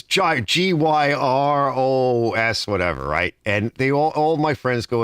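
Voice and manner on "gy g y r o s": G Y R O S spelled letter by letter, slowly; reading the letters off the sign. Shown as a demonstration. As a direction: spell gyros out deliberately. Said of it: "it says".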